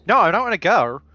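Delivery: high, loud protest; loud refusal. Acted. Character refusing to go along, loud protest. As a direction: refuse outright, voice jumping up.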